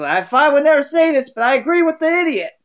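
put-on a tart old lady jab, sped up; Sophia inflection. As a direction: Sophia siding with Dorothy against Rose. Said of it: "Sophia goes".

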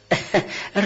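short uneasy laugh; nervous breathy laugh. Acted. (خندهٔ عصبی کوتاه)

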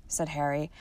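usual speaking voice

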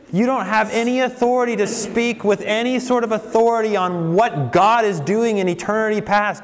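high, put-on voice of indignant hecklers; mocking critics. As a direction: scorn him like a sneering crowd. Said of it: "They say".

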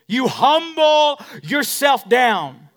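loud preached line. Acted. Pastor declaring to the church, loud and slow.

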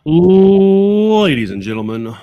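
big drawn-out announcer call, high and loud; loud, high, slow opening fanfare; show-opening announcer. Acted. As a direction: stretch it like a ring announcer introducing the show. Podcast host opens the show.

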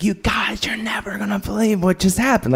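high, airy gushing; mock excited telling. [name] telling strangers about the sexy boat, excited. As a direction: burst to share it in a high half-whisper. Retold urge to tell strangers.

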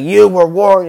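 high, booming villain shout; Vader. Acted. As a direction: belt out the warning in mock fury. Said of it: "he's like".

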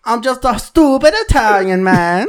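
high, sing-song cartoon Italian; mock Italian accent. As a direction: ham up a high-pitched Italian caricature. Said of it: "Can I make fun of Italian people?".

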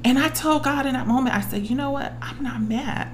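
high, soft, near tears; getting tearful. Real, not acted. Woman telling what she said to God, getting tearful. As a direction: hold back real tears, voice rising. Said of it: "I'm getting tearful about it".

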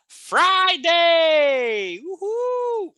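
high, loud, stretched party cheer; playful shout rising into a high woo-hoo; celebratory shout. Pretend. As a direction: whoop it up for the weekend. Celebrates Friday arriving in the lesson.

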